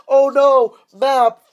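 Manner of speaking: loud, high mock cry; hammed-up wail of loss; mock dismay. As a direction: call out in pretend horror. Acts out losing the map.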